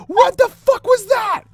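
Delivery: high, loud, drawn-out terrified shriek; panicked scream. Retold or imitated. Andrew, jumping into his friend's bed, terrified high shriek. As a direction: shriek it slowly, still terrified. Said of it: "and is just like".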